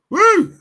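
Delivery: short high, loud woo; loud celebratory "woo"; whooping cheer. Real, not acted. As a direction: let out a sudden piercing whoop. Mock relief at being saved.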